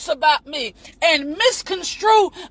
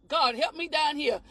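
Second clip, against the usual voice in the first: softer, lower aside to God; muttered aside; 3 semitones lower, 9 dB quieter than usual. Real, not acted. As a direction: drop it under your breath.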